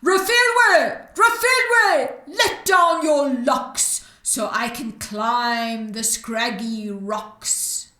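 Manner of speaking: a witch's shouted summons; witch shouting up. Acted. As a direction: bellow her name up to the cave.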